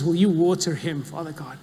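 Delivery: ordinary delivery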